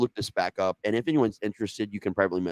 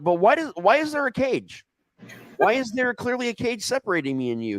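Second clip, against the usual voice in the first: high stoned voice; 13 semitones higher than usual. Retold or imitated. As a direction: puzzle over it in a high, spaced-out voice. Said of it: "I was like".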